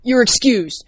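plain reading voice

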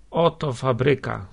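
ordinary delivery

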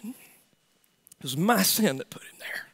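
soft unvoiced whisper; whispered confession. Real, not acted. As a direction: breathe it as a private confession. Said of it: "confession whispered".

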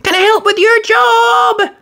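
very high called question; little digger calling out; calling after. Acted. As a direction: shout after a passing train. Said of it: "Alfie called after him".

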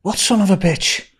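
high puzzled asking; baffled question. Retold or imitated. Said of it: "And I went".

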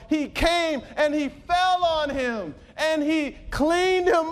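high, loud pulpit proclamation; impassioned preaching. Real, not acted. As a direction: build to a ringing sermon climax. Impassioned preaching about the prodigal.

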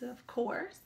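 ordinary delivery

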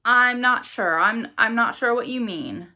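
high, loud, stammering and taken aback; flustered stammer. A narrator's reading. Spokesman thrown by a question on cursed children, stammering. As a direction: stumble over the opening words, caught off guard. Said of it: "stammered Mister Jones".

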